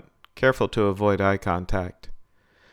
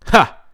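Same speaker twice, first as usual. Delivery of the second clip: sharp high loud cackling laugh; old man cackling at Ben's question, high and loud; cackle; 10 semitones higher, 10 dB louder than usual. A narrator's reading. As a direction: bark out a scornful cackle. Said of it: "the old man cackled".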